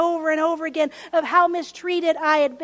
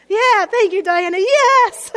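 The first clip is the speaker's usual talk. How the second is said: loud, high excited shout to the congregation; excited cheer; 4 semitones higher, 10 dB louder, slower than usual. Real, not acted. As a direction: cheer her on loudly. Cheering a congregation member's response.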